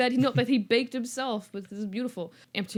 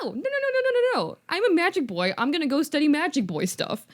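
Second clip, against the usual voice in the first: high, insistent string of refusals; hammed-up kid defiance; defiant mock protest; 6 semitones higher than usual. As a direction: insist excitedly in a raised pitch. Harry defies relatives in retelling.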